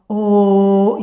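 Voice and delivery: drawn-out loud O; long O vowel. Shown as a demonstration. Teacher demonstrating the O vowel. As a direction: sing out a round O. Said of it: "when we do the O".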